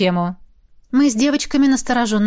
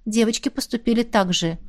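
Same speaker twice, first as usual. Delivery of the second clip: ordinary delivery, nothing marked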